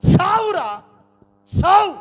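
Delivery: high, loud cry flung out; acted angry shout. Hagar to the son she throws aside, acted angry shout. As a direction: cry it out sharply, acting the rejection.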